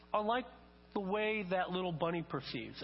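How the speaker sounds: normal talking voice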